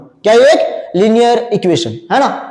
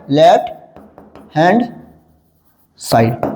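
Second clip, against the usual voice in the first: "Left", "hand", "side", each alone with long pauses; word by word; 7 semitones lower, slower than usual. Shown as a demonstration. Teacher dictates the full form to class, word by word. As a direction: dictate slowly, lower, dropping on the last word.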